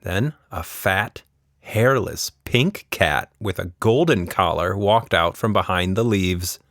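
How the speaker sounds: ordinary delivery, nothing marked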